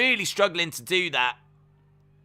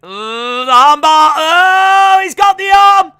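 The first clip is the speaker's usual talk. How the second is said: excited shout; 12 semitones higher, 12 dB louder, slower than usual. Real, not acted. Commentator to viewers, yelling in excitement at the arm bar. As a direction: pitch soaring, shouting as the hold locks in.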